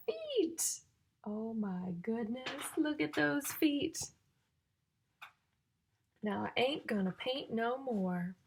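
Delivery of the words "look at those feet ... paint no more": normal talking voice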